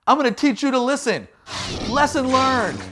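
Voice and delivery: high, fast smug threat; cocky swordsman Peter. Retold or imitated. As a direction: sneer it like a movie hero. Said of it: "Maybe he was like".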